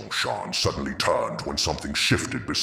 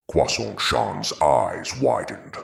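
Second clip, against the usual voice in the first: ordinary delivery, nothing marked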